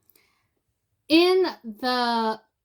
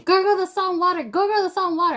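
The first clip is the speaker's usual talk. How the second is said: friend yelling; 6 semitones higher, 4 dB louder, much faster than usual. Retold or imitated. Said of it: "he's all like".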